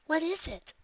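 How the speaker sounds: put-on high, puzzled young unicorn; young Twilight voice. A narrator's reading. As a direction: read it with curious surprise. Twilight asks her mentor.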